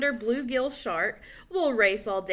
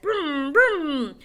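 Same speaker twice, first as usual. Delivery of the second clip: engine noise; 5 semitones higher, 4 dB louder than usual. Acted.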